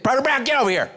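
high-pitched imitation of a sergeant's yell; drill sergeant yell. Drill sergeant picks on Private Brown, mock yell. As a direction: call him out in a mock military holler. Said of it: "didn't know who to yell out".

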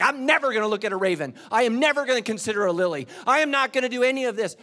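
loud, fast, high defiant refusal; defiant shout. Retold or imitated. Preacher acts out refusing Jesus, loud and defiant. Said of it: "I'm like".

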